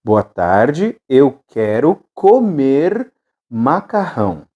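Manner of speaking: BOA TARDE, EU QUERO, COMER, MACARRÃO slowly, in separated chunks; slow dictation. Shown as a demonstration. Teacher repeats phrase slowly for learners. (ditado lento, com pausas entre as partes)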